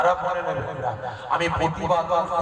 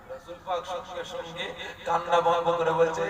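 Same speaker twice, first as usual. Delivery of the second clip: ordinary delivery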